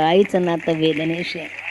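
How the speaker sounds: quiet, slow emphasis on आईचं; soft slow echo. Shown as a demonstration. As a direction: let the line sink softly and slowly. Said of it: "म्हणून लक्षात ठेवा".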